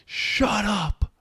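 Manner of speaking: high, sharp mock yell of an angry ref; retold ref yell. Ref yelling at the player to shut up, high mock yell. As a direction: bark it like an irritated ref. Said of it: "yelled at me".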